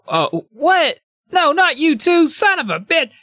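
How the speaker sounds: very high, whining disbelief; acted dismay. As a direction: wail it in mock panic at the betrayal. Jerry betrayed by Toggle.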